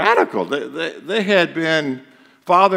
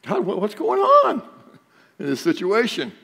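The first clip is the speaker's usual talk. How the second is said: pleading question; 7 semitones higher than usual. Retold or imitated. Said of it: "You had that question before".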